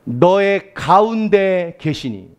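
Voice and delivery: slow, drawn-out recitation; slow emphatic reading. Shown as a demonstration. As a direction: lay each word down slowly. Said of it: "어디 계세요".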